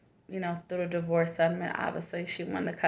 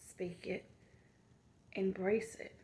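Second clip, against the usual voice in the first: hushed affirmation; 10 dB quieter than usual. Acted. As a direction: speak it, embrace it, daily whispered softly. Affirmations fading to whisper.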